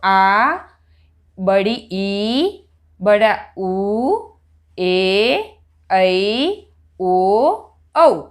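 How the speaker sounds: long vowels held twice as long; long vowels drawn out. Shown as a demonstration. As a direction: stretch each long vowel slowly. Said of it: "उसको हमें कैसे बोलना है?".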